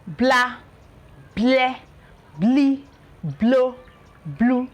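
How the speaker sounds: blah bleh blee blow blue, tongue lifted for a clear L on each; teacher modelling the L sound; L blend demo. Shown as a demonstration. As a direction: model each bl syllable with pauses. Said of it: "This is li".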